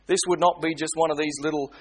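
usual speaking voice

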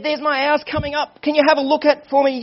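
high-pitched acted nervous urgency; anxious plea. Young man asking the preacher to look for him, anxious plea. Nervous son nearing his house.

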